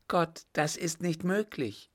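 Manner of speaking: high-pitched, disbelieving exclamation; horrified cry. A narrator's reading. Wife hearing her husband is the murderer, high and horrified. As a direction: protest in horror, pitched well up. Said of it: "rief sie".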